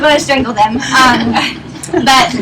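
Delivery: ordinary delivery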